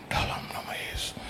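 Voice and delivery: breathy whispered prayer under the breath; hushed whisper, Jesus' name. Retold or imitated. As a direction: pray secretly so they can't hear. Praying silently before collectors.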